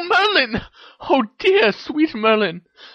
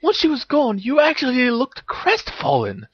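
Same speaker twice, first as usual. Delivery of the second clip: high-pitched excited revelation; exclaiming; 13 semitones higher than usual. A narrator's reading. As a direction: exclaim it high, building your case against him. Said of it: "exclaimed Hermione".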